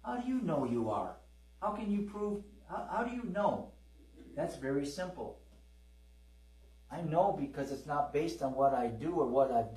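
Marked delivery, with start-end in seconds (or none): 0.0-3.7 higher, softer voice of a doubting church member; lightly voiced skeptic pressing for proof; doubting questioner. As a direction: needle them with the how. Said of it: "and they say".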